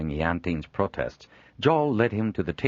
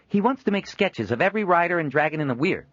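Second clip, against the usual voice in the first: Leopold cutting in; 12 semitones higher, 4 dB louder than usual. Acted. Leopold cuts in.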